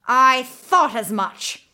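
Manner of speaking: loud, slow triumphant cry; witch crying out. A narrator's reading. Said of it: "the witch cried".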